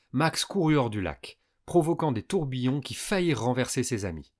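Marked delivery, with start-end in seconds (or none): none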